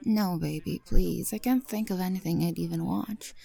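usual speaking voice

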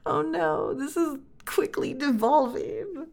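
breathless, words squeezed through real laughter; helpless laughter. Real, not acted. Speaker watching own recording devolve, real helpless laughing. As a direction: dissolve into laughing while speaking.

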